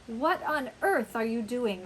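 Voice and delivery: ordinary delivery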